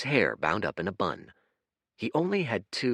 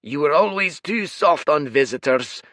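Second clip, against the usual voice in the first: booming creature; 8 semitones higher, 8 dB louder than usual. Acted. Red giant bellowing at the rowing stranger, furious roar. Red one scolds twin.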